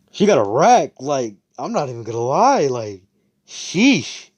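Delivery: high, loud hyped-up admiration; loud, high-pitched hype; impressed exclamation. Retold or imitated. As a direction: burst out in acted awe. Acted ogling exclamation by Asta.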